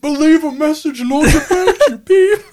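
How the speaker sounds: high, loud voice breaking into laughter; laughing. Acted. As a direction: push through the words while cracking up. Voicemail bit collapsing into laughter.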